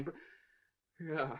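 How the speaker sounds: normal talking voice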